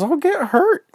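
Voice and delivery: put-on high, loud little-girl protest; squeaky, worried objection; frightened whine. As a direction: whine it fearfully, voice way up. Girl fears getting hurt.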